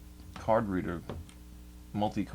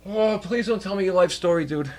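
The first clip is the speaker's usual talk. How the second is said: high, loud groaning complaint; exasperated plea; 10 semitones higher, 5 dB louder than usual. Retold or imitated. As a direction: plead in mock despair to be spared. Retold dread at a rambling stranger.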